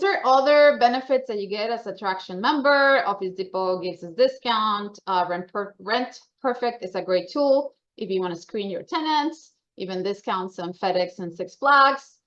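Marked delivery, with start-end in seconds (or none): none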